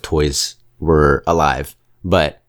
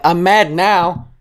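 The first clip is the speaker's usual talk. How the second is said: much higher, slow, drawn out; mock angry retort; 12 semitones higher, slower than usual. Speaker snapping back at Potato Head, hammed-up. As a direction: answer the bully in a put-on pouting voice. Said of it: "I'm like".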